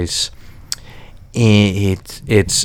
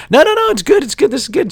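much higher, overeager reassurance; forced enthusiasm; 14 semitones higher, faster than usual. Retold or imitated. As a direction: hurry to insist you love it. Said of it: "No, that".

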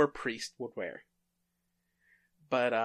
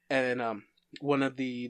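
usual speaking voice

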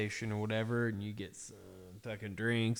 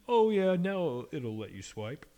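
mocking cashier; 5 semitones higher than usual. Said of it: "don't make me fucking swipe".

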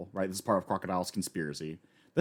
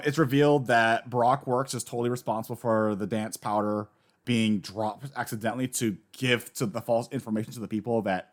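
normal talking voice

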